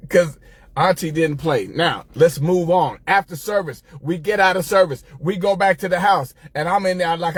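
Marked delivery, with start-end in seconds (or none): none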